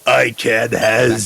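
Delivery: slow, put-on elder meme quote; old-timer voice. Streamer as old internet user quoting lolcats, slow elder voice. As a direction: crow it slowly like an old-timer. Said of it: "upset some people with this voice".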